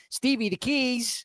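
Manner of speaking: high, slow drawn-out movie quote; imitated movie character. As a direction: draw out the request, pitched high. Mimics film character asking for keys.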